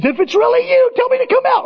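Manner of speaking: very high mock Peter; very high hammed-up Peter; squeaky Peter. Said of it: "Peter says".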